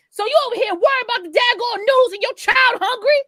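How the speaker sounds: high-pitched indignant mock rant; acted berating. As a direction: scold him like an outraged wife. Acts out mother berating the father.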